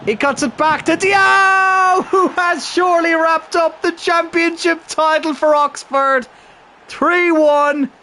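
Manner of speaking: high, slow, roaring goal call; bellowing the winning goal call; excited goal shout. Real, not acted. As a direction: scream the goal like a commentator. Goal scored, commentating wildly.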